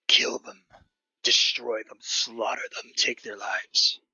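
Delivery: a lightly voiced growling monster whisper. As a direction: urge it like a beast in the mind. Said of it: "that growling voice".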